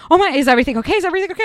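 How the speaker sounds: startled worker asking if all is well; alarmed staffer. Retold or imitated. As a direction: rush in concerned. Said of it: "And he's like".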